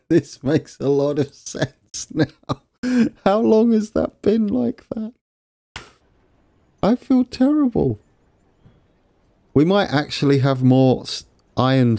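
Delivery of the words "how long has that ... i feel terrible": high-pitched, aghast realisation; dismayed surprise. Real, not acted. Player finds his train running empty for ages, high dismay. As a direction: squeak out the shock of the mistake. Discovers a long-running mistake.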